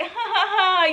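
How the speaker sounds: tipsy, playful giggle, acted out; imitated giggle. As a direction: giggle as if leading someone on. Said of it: "I was doing stuff like that".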